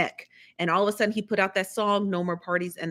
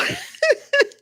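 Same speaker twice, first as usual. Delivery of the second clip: high, loud burst of laughter; laughing out loud; real laugh; 5 dB louder than usual. Real, not acted. Laughs at own shade remark.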